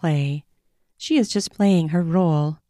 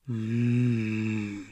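low soft hum of pleasure; a deep contented mmm; savoring moan; 8 semitones lower, 8 dB quieter than usual. A narrator's reading. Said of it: "I moan".

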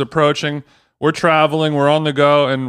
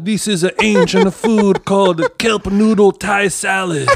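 put-on old Italian guy voice; 5 semitones higher than usual. Podcast host jokingly welcomes co-host in old Italian guy voice. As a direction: play a folksy old Italian guide welcoming guests. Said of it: "I'm doing an old Italian guy voice".

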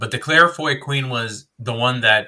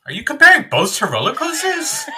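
high, laughing incredulous question; laughing disbelief; 6 dB louder than usual. Real, not acted.

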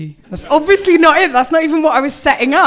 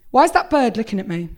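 normal talking voice

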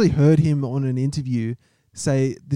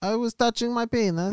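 put-on Borat accent; 10 semitones higher than usual. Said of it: "hard not to do the accent again".

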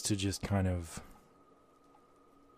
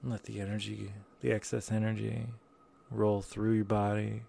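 airy breathy mystical healer; 6 dB quieter than usual. Acted.

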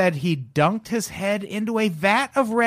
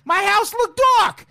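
lightly voiced Brooklyn guru; brooklyn accent; 6 semitones higher than usual. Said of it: "thick Brooklyn accent".

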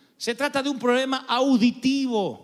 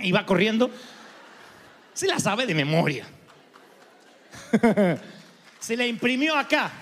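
ordinary talk

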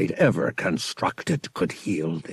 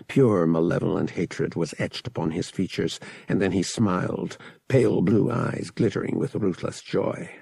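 ordinary delivery

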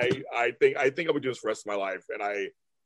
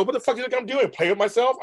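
exasperated retort; 10 semitones higher, faster than usual. Retold or imitated. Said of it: "I go".

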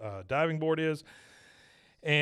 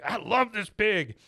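put-on higher, slow, dreamy boy's voice; imitating a delighted boy; 8 semitones higher than usual. Boy in the audience, smitten with the diving pig. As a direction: gush over the pig. Bobby voiced in a retold episode.

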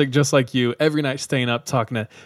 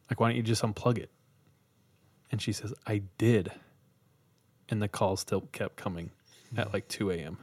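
hushed, lowered campfire story voice; spooky storytelling; 5 dB quieter than usual. Acted. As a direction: draw them into the creepy tale softly.

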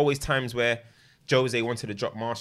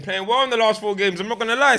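emphatic; 11 semitones higher, 9 dB louder than usual. Real, not acted. Emphatic point.

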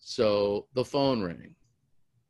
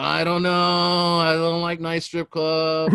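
slow, higher-pitched imitation of a reluctant friend; drawn-out reluctance; 6 semitones higher, 3 dB louder, slower than usual. Friend balking at a nice strip club, mock reluctant drawl. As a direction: drag the words out in mock doubt.

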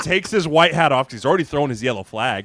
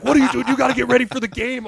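high, loud telling-off; acted coach scolding; 10 semitones higher, 5 dB louder, faster than usual. Coach urging distracted player in warm-ups, imitated loud scolding. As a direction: yell it like an exasperated coach.

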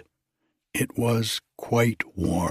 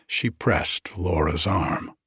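ordinary delivery

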